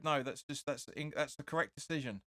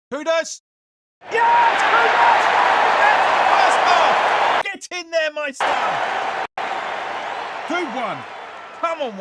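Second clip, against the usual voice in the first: ecstatic shouting, far higher and louder; goal celebration; 13 semitones higher, 16 dB louder, slower than usual. Real, not acted. Fan roaring at the screen after the goal. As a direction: scream it as your team scores.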